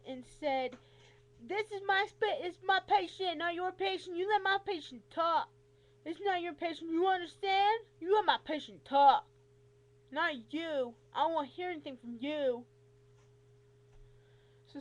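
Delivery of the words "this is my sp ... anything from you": loud, high, mimicked tirade; retold yelling. Rheumatologist yelling at patient's companion, loud and indignant. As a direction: imitate a doctor yelling someone down. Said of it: "started yelling at her".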